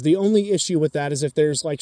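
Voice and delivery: ordinary talk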